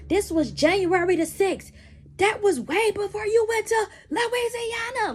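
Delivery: high mock holler, rising into a shout. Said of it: "he's hollering in my face".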